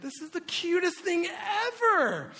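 high, loud, drawn-out squeal of adoration; gushing. Retold or imitated. Dad watching his toddler on a bucket, high-pitched gushing. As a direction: melt over something adorable, voice way up.

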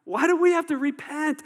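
high, loud indignant cry; shouted, incredulous protest; outraged retort. Acted. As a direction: throw the questions back in acted outrage. Acting out the Pharisees' outraged reply.

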